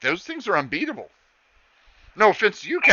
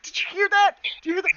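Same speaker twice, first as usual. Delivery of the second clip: starstruck excitement; faster than usual. Acted. The girl squeals at Leon about the Warforged. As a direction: squeal it at your friend. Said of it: "She's like".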